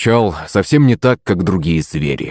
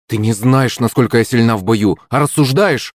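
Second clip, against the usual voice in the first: usual speaking voice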